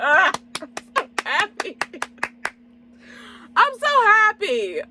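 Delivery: very high, drawn-out happy squeal; overjoyed squeal. Real, not acted. Reviewer gushing over a movie they loved, overjoyed squeal. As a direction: squeal it as if bursting with joy.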